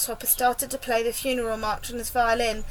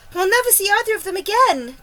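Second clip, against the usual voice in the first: cried; 8 semitones higher, 7 dB louder than usual. A narrator's reading. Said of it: "cried the ladybird".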